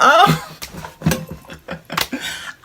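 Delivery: unvoiced laugh; breathy laugh. Real, not acted. Laughs after acted begging.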